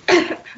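sudden harsh cough; coughing fit. Real, not acted. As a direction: cough hard while sick. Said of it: "I'm sorry, I'm coughing a lot".

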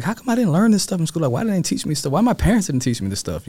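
why did nobody tell me; indignant questioning. Retold or imitated. Driver on the highway protesting what school never taught, indignant. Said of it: "I was like".

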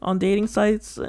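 high, slow mocking whine; drawn-out high mimicry. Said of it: "he's like".

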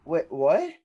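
loud, high puzzled question; confused double take; genuinely questioning. Retold or imitated. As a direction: ask it baffled, pitch jumping up. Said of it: "I literally was asking a question".

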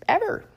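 ever shot up high and loud; emphatic ever. Real, not acted. Host vows to listeners never to take vaccine, loud emphatic ever. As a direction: slam the word ever.

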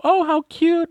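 high cooing "how cute". Retold or imitated. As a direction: gush over something adorable. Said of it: "somebody will see them and will go".

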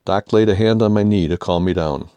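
ordinary delivery

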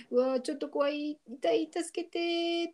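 high, drawn-out cry for help; hammed-up "scared and hurting", without shouting; mock frightened plea. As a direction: play-act a pained, scared wail. Acting Davy grabbed by the arm.

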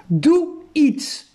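high, urgent call. A narrator's reading. Max calls to Makoto down on the ice, calling out. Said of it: "riep hij naar Makoto".

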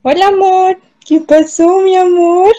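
high, sweet pet-talk voice. Real, not acted. Greeting her dog on camera.

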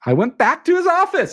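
ordinary talk, nothing marked

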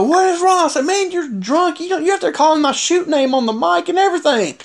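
high-pitched, worked-up retold scolding; mad. Wrestler confronting a drunk colleague over his shoot name, mad. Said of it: "I was mad".

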